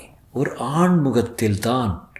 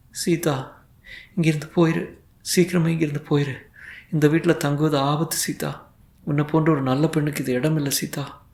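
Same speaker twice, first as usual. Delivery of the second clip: softer, higher hushed plea, a touch of dread; sorrowful warning; 4 semitones higher, 3 dB quieter than usual. A narrator's reading. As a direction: urge her away in quiet sorrow. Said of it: "உலகத்தின் சோகமெல்லாம் நிறைந்திருந்தது".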